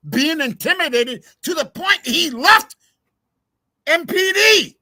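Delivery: rising from irritated rant to furious shout; hollering. Real, not acted. Streamer raging at the detective's appointment, building to a shout. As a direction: build until you're yelling at the screen. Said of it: "sorry for the hollering and the swearing".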